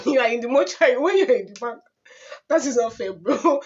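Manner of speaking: loud, laughing scolding; laughing indignation at the mortuary man; mock outrage. Real, not acted.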